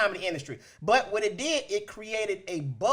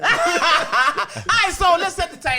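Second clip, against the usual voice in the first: laughing; 9 semitones higher, 6 dB louder, slower than usual. Real, not acted. Regaining control while still laughing.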